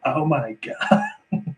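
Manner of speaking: high, drawn-out American tourist gush. Acted. Irishman mocking the camera-toting American tourist, hammed-up American. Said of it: "the stereotype of the American".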